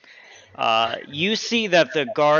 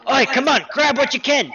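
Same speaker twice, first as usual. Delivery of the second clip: high, raised rallying call; looters calling out; 11 semitones higher, 5 dB louder than usual. Acted. Looter calls his mates into the broken house, high shout. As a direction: urge the gang on, pitched up high. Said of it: "group of guys are like".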